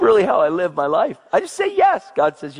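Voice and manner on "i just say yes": normal talking voice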